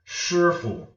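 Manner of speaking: "Shi" held high and level, "fu" dropped short; neutral fifth tone. Shown as a demonstration. Teacher showing viewers where neutral tone comes, high then neutral. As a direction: sustain a high "Shi", then let "fu" fall away unstressed. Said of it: "It just stays the same".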